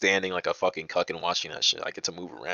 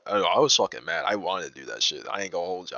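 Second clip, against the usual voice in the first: ordinary talk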